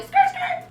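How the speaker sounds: high squealing tire noise, mouth-made; tire screech. Retold or imitated. Telling the audience about driving home, squealing tire imitation. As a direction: mimic screeching tires, way up high.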